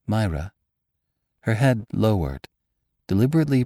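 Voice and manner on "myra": ordinary delivery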